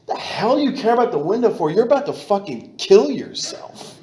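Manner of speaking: high, loud self-rebuke with a laugh; shouting at himself. Retold or imitated. Suicidal drunk scolding himself loudly in the parked car. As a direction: bark the question back at yourself, half laughing.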